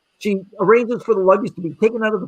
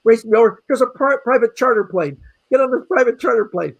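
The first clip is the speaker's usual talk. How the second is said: ordinary talk, nothing marked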